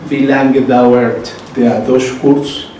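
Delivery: "wie lange" slowly, each word spaced; slow model phrase. Shown as a demonstration. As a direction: spell it out slowly for learners. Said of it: "teacher models German phrases".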